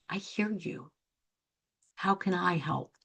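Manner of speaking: modelling a quiet caring reply; calm reassurance. Shown as a demonstration. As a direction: say it like an open ear. What they need to hear.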